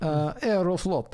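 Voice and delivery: Aeroflot drawn out slowly, rolled like Italian; Italian-sounding Aeroflot. Shown as a demonstration. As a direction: say Aeroflot as if it were Italian, stretched and sung. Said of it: "that just makes it Italian".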